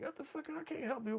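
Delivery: very high, soft squeak; baffled aside. Acted. Inmate asked for advice he can't give, hushed high disbelief. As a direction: squeak it under the breath in disbelief.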